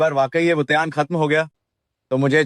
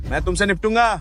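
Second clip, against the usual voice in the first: raised-pitch threat of revenge; angry, higher-pitched outburst; 5 semitones higher than usual. Acted. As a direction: threaten him, pitching your voice up. Threatens friend who abandoned him.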